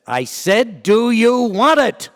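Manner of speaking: loud, high, slow angry bark; angry demand. Retold or imitated. Angry major repeating his question, loud and slow. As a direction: slam each word like a drill sergeant. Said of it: "And he got mad".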